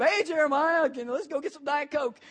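high, loud, bright greeting; hammed-up eager leader calling out; cheery youth leader. Youth leader voiced greeting loudly.

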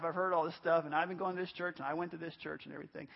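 low, trailing-off mock inner thought; dismissive churchgoer. Preacher voicing a churchgoer who has heard it all, dismissive.

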